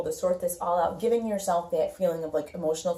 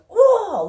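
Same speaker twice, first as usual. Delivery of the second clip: sudden high, loud exasperated oh; overwhelmed exclamation; 13 dB louder than usual. Retold or imitated. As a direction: blurt it out like you can't take one more thing. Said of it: "that feeling where you're like".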